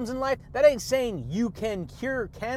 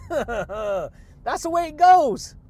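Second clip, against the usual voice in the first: drawn-out laughing delivery, much higher and louder; laughing catchphrase; 6 semitones higher, 6 dB louder, much slower than usual. Real, not acted. Laughing to his listeners about how life goes. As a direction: laugh the words out slowly.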